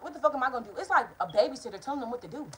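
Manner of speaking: ordinary delivery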